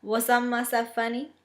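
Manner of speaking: innocent question in a put-on Southern drawl; amateur Southern accent, mock-innocent. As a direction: ask it with a playful Southern twang. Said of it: "keeping her Southern drawl".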